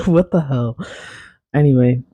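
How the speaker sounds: ordinary talk, nothing marked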